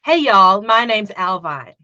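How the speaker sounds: put-on Southern accent, starting loud; American Southern accent. Shown as a demonstration. Guest copies the host's line on request, Southern accent. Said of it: "Can you do a Southern accent".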